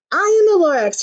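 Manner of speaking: small grumpy creature; Lorax voice. Acted. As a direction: raise the pitch, grumpy forest guardian. Lorax speaks.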